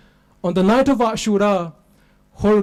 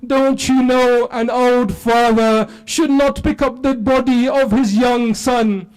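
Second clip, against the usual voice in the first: higher, louder and slow, pleading; slow, impassioned wail for the father; impassioned preaching plea; 6 semitones higher, 4 dB louder, slower than usual. Acted. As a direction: cry it out as a grieving plea. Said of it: "I wish someone was there for you to say".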